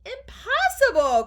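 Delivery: high, loud, stretched exclamation; cried out. A narrator's reading. Wonka denies the marshmallow fate, cried out. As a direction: exclaim it grandly in protest. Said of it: "cried Mister Wonka".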